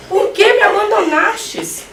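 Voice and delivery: high, slow, hammed-up plea; shamelessly aggrieved, stretched-out question; brazen mock lament. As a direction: wail it in mock reproach to God. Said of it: "Na maior cara de pau".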